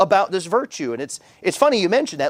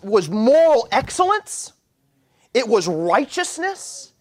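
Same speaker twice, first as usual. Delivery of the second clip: building sermon; 5 semitones higher than usual. Acted. Pastor drives home the point on virtue, preaching with rising fervor.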